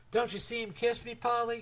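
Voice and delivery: put-on high, eager boy's voice; excited child cry. A narrator's reading. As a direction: cry out with joy to his sister. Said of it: "cried Trembling".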